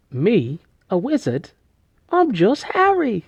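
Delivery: deliberately terrible mock British accent, pitch climbing high; hammy British accent, rising to a squeak on "I'm just Harry"; silly over-the-top British accent as a wide-eyed boy; bad British accent. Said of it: "Stupidest British accent".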